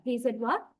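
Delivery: "what" rising at the end; rising echo question. Shown as a demonstration. Teacher showing an echo question for something missed, "what" rising. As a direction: ask for a repeat, pitch lifting on "what". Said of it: "you would be rising your".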